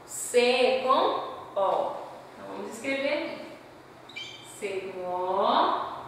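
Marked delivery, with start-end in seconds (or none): none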